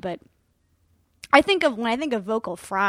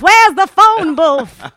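much louder, higher theatrical call, slowed; grand old-Hollywood voice; 8 semitones higher, 15 dB louder, much slower than usual. Acted. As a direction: fling it out like a film star. Joining the Hepburn bit.

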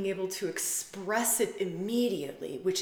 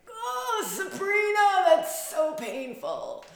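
high, slow syrupy sympathy, hammed up; mock pity; 13 semitones higher, 7 dB louder, slower than usual. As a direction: coo it like a fake comforter. Mocking others' pity for her.

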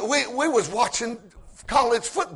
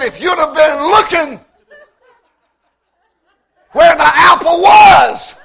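louder, higher, slowing retort with laughter; retorting yell; 7 semitones higher, 11 dB louder than usual. Retold or imitated. Wife retorts to husband, loud and laughing. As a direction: throw it back at him, laughing.

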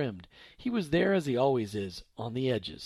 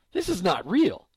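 voice breaking; 3 semitones higher, 6 dB louder than usual. Acted. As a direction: voice cracks on the last word. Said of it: "And her voice broke".

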